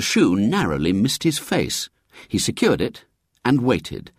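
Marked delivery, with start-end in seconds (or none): none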